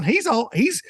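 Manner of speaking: normal talking voice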